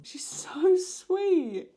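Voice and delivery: high, loud and drawn-out coo over something cute; squealing gush. Real, not acted. Reacting to the young character, a high slow squeal. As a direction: stretch it out in a delighted squeal.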